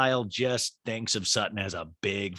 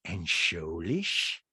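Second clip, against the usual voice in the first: low, very slow drawl; slow mock interrogation, pitched down; Rinna; 5 semitones lower, 4 dB quieter, slower than usual. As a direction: drag the question out in a low put-on voice. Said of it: "And then Rinna goes".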